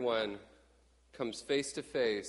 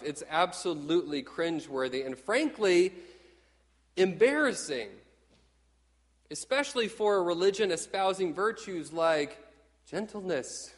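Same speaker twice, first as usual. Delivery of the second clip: ordinary talk, nothing marked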